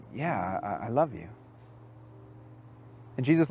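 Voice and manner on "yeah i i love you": a much softer, lower, faltering yes; quiet broken confession; hushed, ashamed answer. Retold or imitated. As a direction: barely get the words out, as if ashamed. Peter answers Jesus, ashamed.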